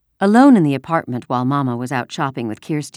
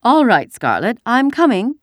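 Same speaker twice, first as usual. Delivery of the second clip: high, loud play-acted lady; girl putting on a sophisticated voice; 9 semitones higher, 7 dB louder than usual. A narrator's reading. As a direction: answer as a sophisticated lady in make-believe. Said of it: "replied in a sophisticated voice".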